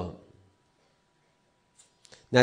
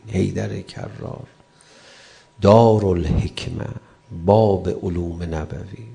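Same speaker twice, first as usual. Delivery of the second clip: low, slow, solemn listing of honorifics; measured, devotional roll of titles; reverent recitation; 4 semitones lower, 3 dB louder than usual. Real, not acted. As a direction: intone each holy title slowly, with weight. Recites reverent titles of Imam Ali.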